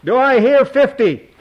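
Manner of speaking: loud high auctioneer shout. A narrator's reading. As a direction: shout for the final bid. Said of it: "Art shouted".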